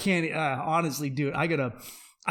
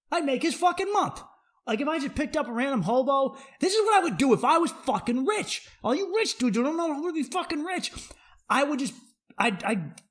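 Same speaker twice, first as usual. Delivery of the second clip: excited rant; 10 semitones higher than usual. Real, not acted. Ranting excitedly about hobo plan.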